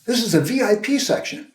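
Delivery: high-pitched indignant scolding; mock yell. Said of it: "a woman yells at me".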